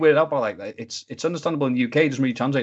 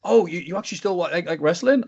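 higher-pitched imitation of a surprised fan; mock surprise; 7 semitones higher than usual. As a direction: mimic a mildly incredulous fan, pitched up. Mimics people surprised he watches wrestling.

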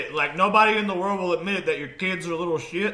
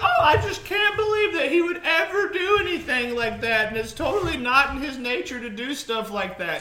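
mocking mother; 8 semitones higher than usual. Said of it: "She was like".

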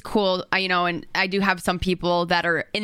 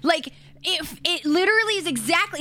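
high incredulous; 10 semitones higher than usual. Real, not acted. Pressing the point about blonde coffee.

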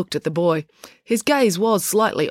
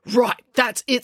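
fed up; 6 semitones higher, slower than usual. Acted. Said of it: "said April".

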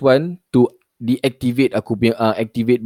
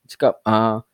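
ordinary talk, nothing marked

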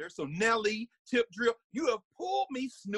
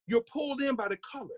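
ordinary delivery

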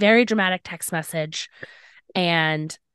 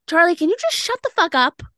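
higher, louder fed-up telling-off; angry outburst; 7 semitones higher, 4 dB louder than usual. Retold or imitated. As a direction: snap at him to shut up, retold with heat. Retold telling boyfriend to shut up.

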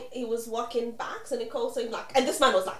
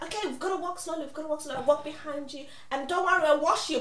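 reassuring friend; 6 semitones higher, 3 dB louder, much faster than usual. Retold or imitated. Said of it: "and then was like".

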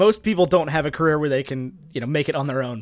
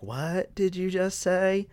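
slow, quiet mock disbelief; stretched-out imitated bafflement; retold disbelief; 3 semitones higher, 7 dB quieter, much slower than usual. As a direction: draw it out as an incredulous reaction. Retells people reacting to slipped accent.

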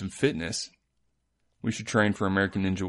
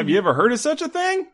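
mock infomercial; 14 semitones higher, 3 dB louder than usual. Hammed-up ad read enthusiasm.

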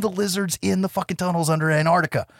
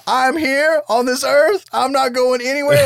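planting each phrase in a high imitation; firmly defiant; 7 semitones higher, 4 dB louder than usual. John refusing to leave the earth, mock defiant. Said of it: "keeps his feet firmly planted".

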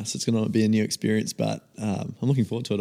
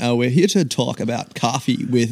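high, loud put-on American accent; exaggerated American accent; 4 semitones higher, 6 dB louder than usual. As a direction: play an American podcast host, loudly. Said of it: "I kind of love the American accent".

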